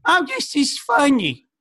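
put-on high, slow, sweet older lady voice; chirpy old lady gushing; mom's friends. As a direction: coo it like a doting mom friend. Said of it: "they'll be like".

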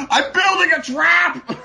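high, loud, drawn-out yell; imitated unhinged shout. Co-host quoting the film's crazed father, hammed-up scream. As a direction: bellow it slowly like a madman.

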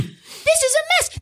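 high, whiny mock lament; exasperated. Exasperated at team's tanking.

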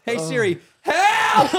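high, loud, crying yell; desperate cry for help. Pretend. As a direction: wail for help, laughing.

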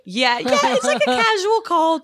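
sarcastic, high mocking voice. Mocking friend's naive question.

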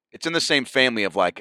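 ordinary delivery

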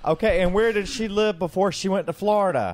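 New York accent. Shown as a demonstration. Answering friends who doubt the accent, in New York accent. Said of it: "Okay, that was close".

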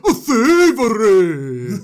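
slow, loud, wailing quote; mock outraged quote. Messenger quoting the angry manager, slow mock wail. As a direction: drag it out like a grand accusation. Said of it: "to quote".

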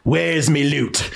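mock British thief-master accent; Dickensian gang boss. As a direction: play a hammy British boss of orphan thieves. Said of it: "look like he's running".

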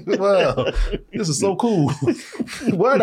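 usual speaking voice

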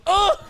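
high, loud mock yelp of pain; imitated yelp. Retelling friend hurting himself, high loud yelp. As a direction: yelp like something just gave out. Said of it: "and he goes".

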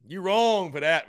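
drawn out playful scold. Real, not acted.

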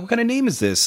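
very high, incredulous squeal of a question; mock disbelief. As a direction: act out being utterly baffled, pitch way up. Retells his baffled reaction to name.

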